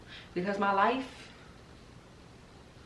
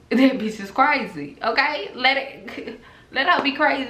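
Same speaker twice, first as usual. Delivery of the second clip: laughing; 6 semitones higher, 8 dB louder than usual. Retold or imitated. Laughing while quoting own advice.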